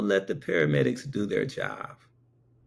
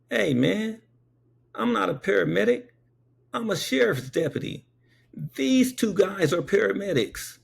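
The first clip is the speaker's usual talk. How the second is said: high, emphatic retold correction; acted exasperated deputy, pitched high; sheriff's deputy; 11 semitones higher than usual. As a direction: set him straight in a raised pitch. Acting out sheriff's deputy correcting him.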